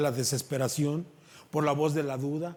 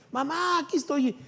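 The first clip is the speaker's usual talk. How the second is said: put-on high, louder little child's call; 12 semitones higher, 6 dB louder than usual. Little child calling to his mother, high voice. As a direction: call out like the smallest kid.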